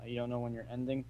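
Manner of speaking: faint, barely audible words; really quiet. Real, not acted. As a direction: say it almost too quietly to hear. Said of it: "You're really quiet".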